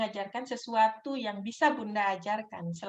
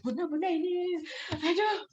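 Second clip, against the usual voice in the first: put-on high, pestering little child's voice; a child tugging at a busy mother, high and whiny; 7 semitones higher than usual. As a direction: pester mum like a restless kid. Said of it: "dia bolak-balik".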